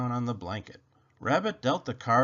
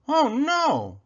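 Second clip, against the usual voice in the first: high, loud moan of dismay; 13 semitones higher, 6 dB louder than usual. A narrator's reading. Rabbit finds the remote missing, high loud moan. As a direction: wail it high, then let it fall. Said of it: "moaned Rabbit".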